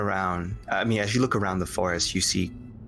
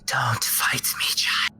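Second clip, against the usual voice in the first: ghostly whisper; 4 semitones higher than usual. Acted. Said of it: "She says".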